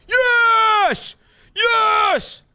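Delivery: very high, loud victory shouts; triumphant yells. Real, not acted. Streamer whose craft just hit, triumphant yells. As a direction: scream with joy.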